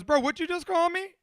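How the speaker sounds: high, loud indignant challenge; taken-aback tough guy, rising pitch; affronted straight man. Retold or imitated. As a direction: push it up into an offended question. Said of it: "He goes".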